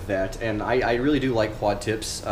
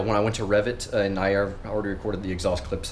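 usual speaking voice

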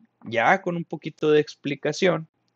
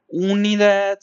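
UNIDAD higher and louder, first sound held; énfasis didáctico; 7 semitones higher, 5 dB louder than usual. Shown as a demonstration. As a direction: stress "Unidad" so the class notices it. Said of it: "fíjense". ("Unidad" con énfasis, más agudo y fuerte)

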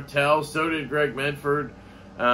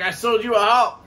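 higher, louder imitation of another man; retold call-out; 7 semitones higher, 5 dB louder than usual. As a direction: call it over show noise, retold. Ryan calling over loud show noise.

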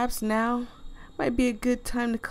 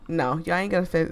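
usual speaking voice

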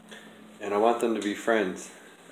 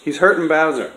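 frustrated loud; 5 semitones higher, 10 dB louder than usual. Real, not acted. Frustrated owner while holding cat.

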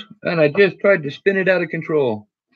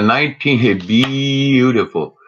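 slow admiring; much slower than usual. Real, not acted. Admiring a coin.

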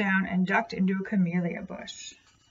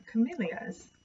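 plain reading voice

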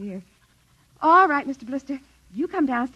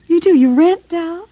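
higher, louder incredulous question; astonished; 8 semitones higher, 9 dB louder than usual. Acted. Mother questions the doll maker's odd offer, astonished. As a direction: voice jumps up in surprise.

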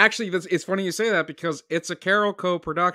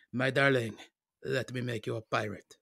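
put-on soft, low French-accented sweet talk; bad French accent; 4 semitones lower, 7 dB quieter than usual. Imagining the director wooing his wife, soft mock French accent. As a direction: lightly put on a French accent, fading softer. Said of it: "That was my French".

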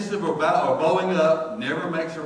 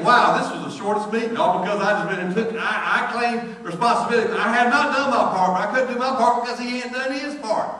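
loud, high, rising preacher's build; preaching; 7 semitones higher, 7 dB louder than usual. Acted. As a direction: drive it home to the congregation. Preaching builds to full force.

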